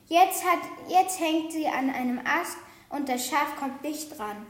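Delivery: plain reading voice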